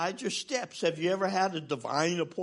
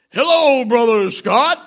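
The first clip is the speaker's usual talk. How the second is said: tall pastor's greeting; 3 semitones higher, 14 dB louder, slower than usual. Retold or imitated. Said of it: "And he's got a deep voice".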